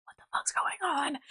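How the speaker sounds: whispered mock bewilderment; baffled whisper. Son standing behind his dad, whispering in bafflement.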